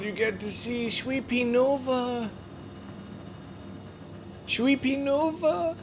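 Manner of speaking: high, slow cooing pet voice. Real, not acted.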